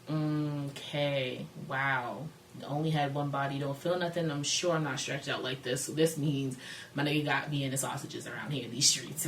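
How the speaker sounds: low, soft and underwhelmed; unimpressed inner monologue. Retold or imitated. Storyteller voicing her private thoughts during bad sex, underwhelmed. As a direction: think it to yourself, quietly disappointed.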